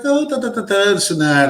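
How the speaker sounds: high-pitched mock chatter, falling as it goes; mimicked chatty woman. Woman chatting on the ferry, mimicked high prattle.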